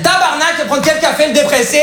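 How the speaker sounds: put-on high, brash Québécois accent; Quebec waitress teasing in a broad Québécois accent. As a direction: blurt it like a blunt Quebec waitress. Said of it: "j'ai pris l'accent québécois".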